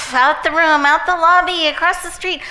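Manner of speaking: high, loud sweeping calls; excited calling out. Acted. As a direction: send it far with each phrase. Sending the box off with the breath.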